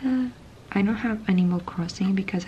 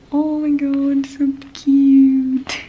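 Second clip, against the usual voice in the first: delighted; 8 semitones higher, 6 dB louder, much slower than usual. Real, not acted. Delighted at a cute gift.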